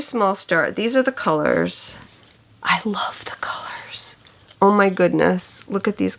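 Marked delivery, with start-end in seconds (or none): 2.6-4.2 soft whispered gush; delighted whisper. Real, not acted. Stitcher showing viewers her new colors, whispered. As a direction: breathe it out, smitten with the colors. Gushing over floss colors.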